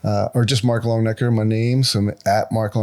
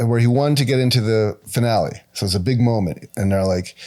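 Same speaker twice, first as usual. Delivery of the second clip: ordinary delivery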